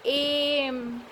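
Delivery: एम held long on its opening "e" vowel; drawn-out letter sound. Shown as a demonstration. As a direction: stretch एम out softly, vowel first. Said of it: "तो इसका उच्चारण क्या है?".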